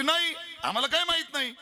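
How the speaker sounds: high-pitched crowd reply; audience answering together. Acted. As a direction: call back as a whole crowd. Whole audience answers the herder.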